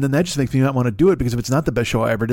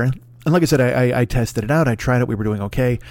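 usual speaking voice

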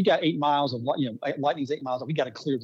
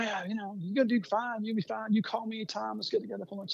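higher and much softer, kindly; reassuring old boss; 7 semitones higher, 8 dB quieter than usual. Retold or imitated. Veteran promoter reassuring the new manager, soft and kind. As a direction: gently reassure the nervous newcomer.

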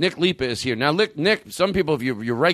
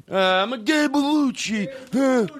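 high, slow hammy Italian voice; Italian caricature; 14 semitones higher, much slower than usual. Pretend. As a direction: stretch it out like a cartoon Italian.

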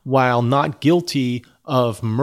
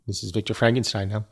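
ordinary delivery, nothing marked

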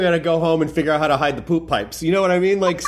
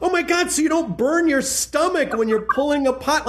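excited realization; 9 semitones higher than usual. Retold or imitated. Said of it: "And Lydia goes".

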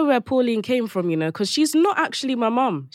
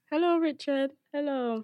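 soft, lightly voiced older Caribbean mum greeting; a hint of Pauline, hushed and polite; 11 dB quieter than usual. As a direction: Pauline meets Richard, soft hello. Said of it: "so Pauline was in the room".

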